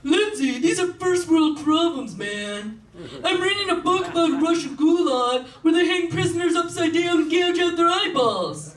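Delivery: mock earnest lecture; lecturing friend. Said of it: "Michael's always trying to teach me".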